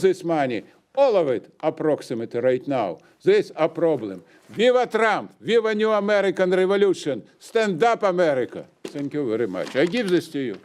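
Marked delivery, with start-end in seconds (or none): 4.5-8.6 raised, higher chant of slogans; rally cry. Real, not acted. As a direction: shout it like a rally finish. Rally cry closing public comment.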